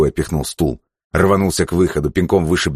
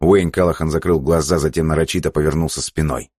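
usual speaking voice

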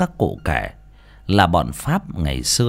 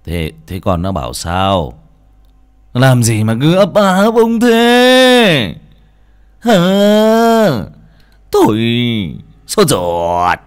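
husband pressing his stammering wife, higher and louder, slow; impatient urging; 4 semitones higher, 8 dB louder, slower than usual. A narrator's reading. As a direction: come on, spit it out, exasperated. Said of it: "liền lên tiếng thúc giục".

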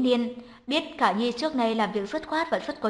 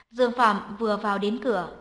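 ordinary delivery

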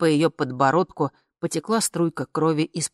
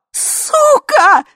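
very high, loud yell; piercingly high outraged shout; screamed curse; 11 dB louder than usual. A narrator's reading. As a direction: scream the insult. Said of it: "орала Ви".

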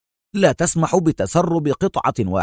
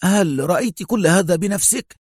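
raised-pitch, firm challenge; firm questioning; 9 semitones higher than usual. A narrator's reading. Consul questioning Mona about what she saw, firmly. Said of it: "سألها القنصل في حزم".

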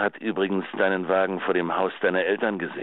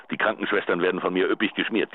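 ordinary talk

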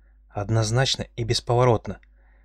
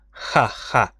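mocking laugh. A narrator's reading. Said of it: "издевательский хохот".

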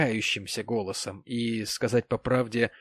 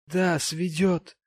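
high, very slow despairing affirmation; 7 semitones higher, 4 dB louder, much slower than usual. A narrator's reading. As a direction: drag it out in maudlin despair. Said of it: "в отчаянии махнув на всё рукой".

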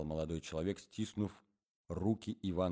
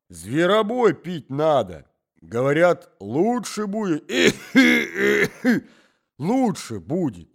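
put-on higher, louder and slow old man; a garrulous old man starting a tale; old man's voice; 9 semitones higher, 15 dB louder, slower than usual.

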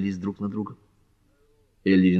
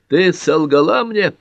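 much higher, louder, slower accusing shout; 10 semitones higher, 6 dB louder, slower than usual. A narrator's reading. Carter accusing Pat, angry shout. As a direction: yell the accusation. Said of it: "крикнул Картер".

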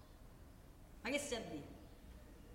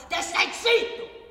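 higher, louder, slow "te stai zitto!"; shouted refrain; 6 semitones higher, 7 dB louder, slower than usual. Acted. Worker ranting about silenced workers, shouted slowly. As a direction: hammer the refrain home. Rhetorical rant.

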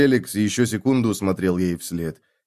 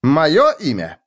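louder outraged moyo imya with stress on моё; a fake lord outraged to be asked his name; indignant exclamation; 5 semitones higher, 5 dB louder, slower than usual. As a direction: act scandalised at the question. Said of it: "воскликнул Феликс".

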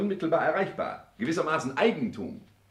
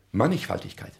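ordinary delivery

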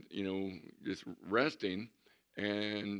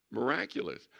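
usual speaking voice

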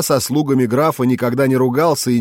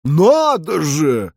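slow, drawn-out sarcastic exclamation; mocking; 4 semitones higher, much slower than usual. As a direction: stretch it out with open mockery. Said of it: "издевательски сказал я". (издевательски)

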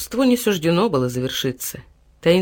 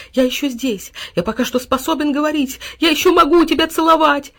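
high, eager voice building louder; excited dog; 8 semitones higher, 4 dB louder than usual. A narrator's reading. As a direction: gush like an overjoyed dog. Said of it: "опять принялся прыгать вокруг". (восторженный пёс)

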